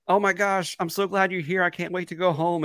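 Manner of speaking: high, loud, overjoyed gush; bursting with relief at finally seeing her; gushing Ezra. Retold or imitated. Ezra gushing to Sabine about going home, high and loud. Ezra's gushing message voiced.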